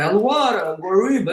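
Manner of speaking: Latin name with thick American r's; mock American accent. Imitating an American reading a scientific name, mock American accent. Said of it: "com aquele sotaque esquisito dele".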